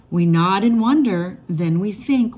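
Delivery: usual speaking voice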